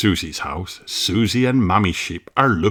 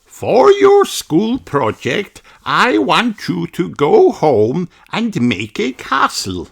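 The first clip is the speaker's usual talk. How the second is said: higher, slow teacher voice; teacher Madame Gazelle; 10 semitones higher, slower than usual. Acted. Teacher setting the class homework, higher, slow voice. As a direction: address the class kindly as their teacher. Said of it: "says Madame Gazelle".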